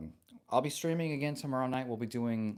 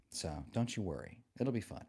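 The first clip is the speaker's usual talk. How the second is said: normal talking voice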